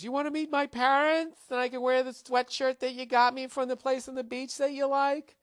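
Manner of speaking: high, mock girly excited voice; hammed-up girlfriend asking sweetly. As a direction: parody of an eager girlfriend. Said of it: "when she goes".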